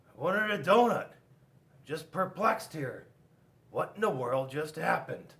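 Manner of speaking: upset at being refused; a hint of a put-out skunk; perplexed skunk.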